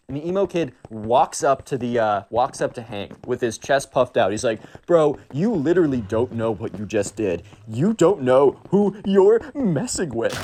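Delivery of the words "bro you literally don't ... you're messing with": mock tough-guy threat. Emo kid threatening Hank in the store, slow and puffed-up. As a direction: puff up and drag out the menace.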